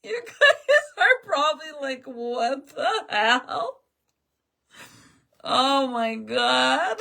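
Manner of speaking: talking through high laughter; laughing. Real, not acted. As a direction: giggle through the words. Laughing after the boo.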